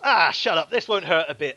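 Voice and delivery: loud mocking thug; Scarface voice. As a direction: brush off her words, sneering. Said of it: "he goes".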